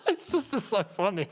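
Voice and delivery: talking through helpless laughter; laughing. Real, not acted. Streamer amused by his Trump attempt, laughing. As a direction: squeeze the words out while cracking up.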